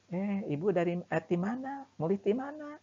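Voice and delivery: high, chatty neighbourly greeting; friendly Sundanese greeting. Retold or imitated. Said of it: "Gimana orang Sunda misalnya ketika ketemu". (sapaan ramah orang Sunda dengan nada tinggi)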